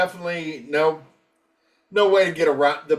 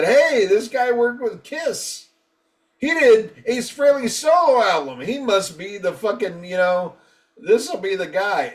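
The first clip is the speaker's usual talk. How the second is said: higher-pitched, eager retold pitch; mock enthusiasm; 6 semitones higher than usual. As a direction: imitate someone excitedly talking up their pick. Voicing band's hopeful producer choice.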